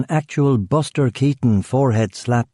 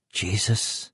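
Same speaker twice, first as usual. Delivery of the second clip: muttered; 8 dB quieter than usual. A narrator's reading. Killian finding a body, muttered curse. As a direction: mutter it under your breath. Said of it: "Killian muttered".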